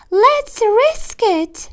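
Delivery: wife deciding to let the fox in, squeaky mouse voice; small mouse wife. Acted. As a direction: pipe it up like a tiny mouse lady. Mrs DeSoto's voice.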